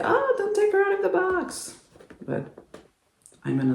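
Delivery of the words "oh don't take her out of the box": squeaky mock protest, pitched way up; mocking high voice. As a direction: play a fussy collector, pitched way up. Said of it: "some people will say".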